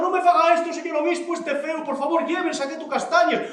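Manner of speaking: high, tearful acted begging; nearly crying. Manolona begs the bishop to take her chestnuts, nearly crying. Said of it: "Manolona casi llorando".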